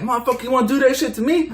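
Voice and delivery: high, fast imitation of her fury; mock angry threat. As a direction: rush it out high, mimicking her rage. Acting out her attack while driving.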